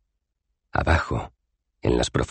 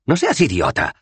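louder, high annoyed reply; snappy retort; 10 semitones higher, 5 dB louder than usual. Acted. Wizard snapping at Dos Flores, annoyed. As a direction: snap at him. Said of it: "replicó el mago".

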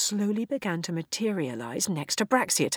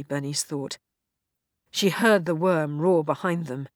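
ordinary delivery

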